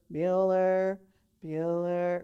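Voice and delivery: dead flat bored teacher calling roll; Ben Stein monotone. Retold or imitated. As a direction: drone the name with zero life, like a sleepy teacher. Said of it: "Ben Stein's teacher character".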